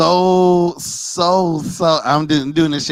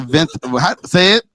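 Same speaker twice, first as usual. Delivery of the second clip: ordinary talk, nothing marked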